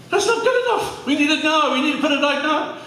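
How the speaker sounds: high-pitched mock urgency, a laugh breaking in; impatient demand. Sound department demanding the image now, high mock urgency. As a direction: squeak it out like an impatient office boss.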